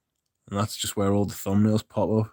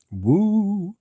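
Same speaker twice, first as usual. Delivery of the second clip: high excited woo; 10 semitones higher than usual. Real, not acted. Sudden cheer to the chat.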